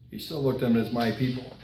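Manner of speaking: tender hush. Real, not acted. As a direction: drop to a soft, tender near-hush. Preacher tenderly names God's people.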